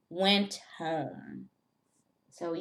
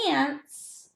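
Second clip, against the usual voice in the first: spring the word out high; high playful pop; 8 semitones higher, 4 dB louder than usual. Acted. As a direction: jump up high and a bit louder. Teacher pops word after whisper.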